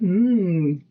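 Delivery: loud mock yummy hum; savoring mmm. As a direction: relish it loudly. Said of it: "I put it in my mouth and went".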